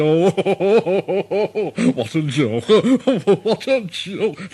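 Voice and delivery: big engine chortling through the words; laughing Gordon. A narrator's reading. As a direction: laugh it off as a great joke. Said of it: "he started to laugh".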